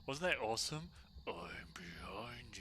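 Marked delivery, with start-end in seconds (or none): 1.3-2.6 ghost whisper. Retold or imitated. Mimics ghost's reply.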